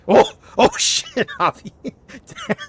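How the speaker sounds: high, amused exclamations; laughing disbelief; laughing shock. Real, not acted. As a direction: react stunned, breaking into laughter. Laughing at customer's strong reaction.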